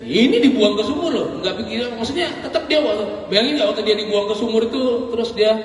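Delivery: high, loud, laughing through the words; can't stop giggling as you tell it; laughing while talking. Real, not acted. Preacher laughing at Yusuf thrown in the well, high and loud.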